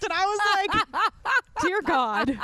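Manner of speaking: laughing out loud through the words. Real, not acted. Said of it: "laughing while telling".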